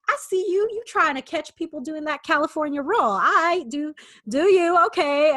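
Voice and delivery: sing-song "I see you" tease; teasing taunt. Retold or imitated. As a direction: call him out playfully. Taunting the hidden cop.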